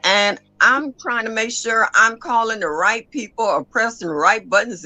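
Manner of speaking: ordinary talk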